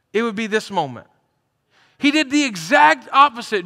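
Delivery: full-force preacher build; preaching. Acted. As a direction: drive it home from the pulpit.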